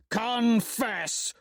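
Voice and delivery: high, bellowed demand; roar. Acted. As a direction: bellow the word at him like a charge. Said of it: "I roar".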